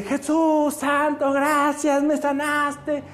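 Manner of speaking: high, drawn-out cries of thanks; euphoric. Acted. Healed leper Juan crying thanks to Jesus, euphoric. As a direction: praise him euphorically, stretching the words. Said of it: "Pero así, eufórico".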